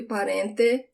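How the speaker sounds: "parente" ending in an open "teh"; "parente" said pa-REN-teh; regional TE as "teh". Shown as a demonstration. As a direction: finish "parente" with a hard T and an E sound. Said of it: "the sound of the T E".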